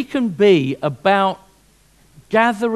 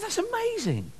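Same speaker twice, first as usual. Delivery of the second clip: shop owner; 14 semitones higher, 9 dB quieter than usual. Acted. Shop owner voiced in parrot joke.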